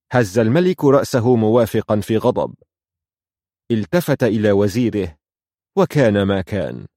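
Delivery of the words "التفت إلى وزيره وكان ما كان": ordinary delivery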